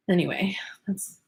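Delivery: soft whispered aside. Real, not acted. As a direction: whisper it under your breath.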